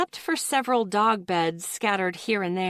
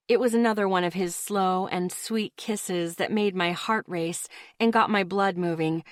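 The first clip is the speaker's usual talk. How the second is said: ordinary delivery, nothing marked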